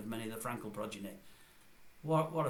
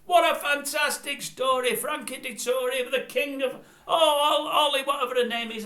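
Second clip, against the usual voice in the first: mock gushing presenter; 12 semitones higher, 7 dB louder than usual. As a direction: gush mockingly over the race story. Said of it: "At the end of every race".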